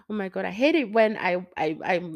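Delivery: usual speaking voice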